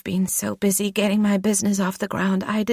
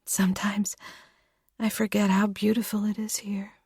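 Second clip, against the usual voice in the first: whispered and much softer, wistful; hushed wistful whisper; 6 semitones higher, 7 dB quieter than usual. Acted. Lou musing to Sarah about the lake, whispered. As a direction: breathe it out while gazing away.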